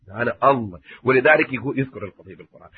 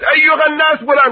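accusing crowd cry; 13 semitones higher, 6 dB louder than usual. Retold or imitated. Voicing a public accuser.

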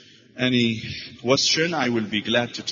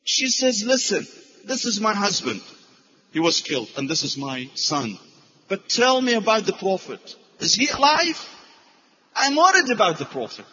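high, louder pleading woman's voice; urgent worried asking, raised high; anxious widow; 8 semitones higher, 3 dB louder than usual. Retold or imitated. Grieving woman asks about Prophet.

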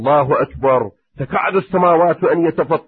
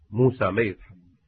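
normal talking voice

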